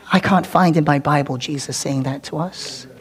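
usual speaking voice

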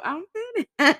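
high-pitched mimic of a little kid; mock the toddler's call; child's voice imitation. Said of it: "it was the voice though".

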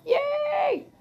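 a very high, quick little "Yay!"; mock cheer. Real, not acted. Patient celebrating that her bone grew back, squeaky cheer.

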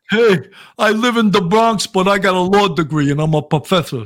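a light New York accent imitating a professor from the Bronx; a Bronx professor, lightly played with a New York accent; intellectual New York accent. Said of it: "intellectual accent".

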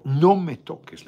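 loud, slow and firm warning; firm shout. Retold or imitated. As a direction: bark it slowly to stop him. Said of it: "en ese tono". (grito firme y lento: «no me toques»)

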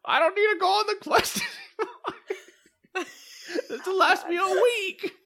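put-on high, laughing old man. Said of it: "The old man's like".

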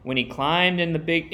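usual speaking voice

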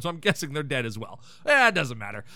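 normal talking voice

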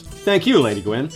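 ordinary talk, nothing marked

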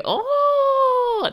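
high, loud squeal of delight; excited squeal. Real, not acted. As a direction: let out a thrilled high oh. Thrilled about flowers from crush.

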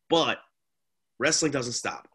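normal talking voice

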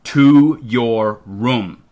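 slow, heavy, loud command; firm order. A narrator's reading. Dad sends the child off to the bedroom, firmly. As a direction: land each word separately and firmly. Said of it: "quietly but firmly".

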